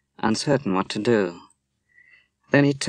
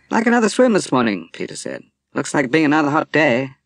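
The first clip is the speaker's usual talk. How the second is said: cheerful invitation; 6 semitones higher, 5 dB louder than usual. Acted. Peter cheerfully offering a swim.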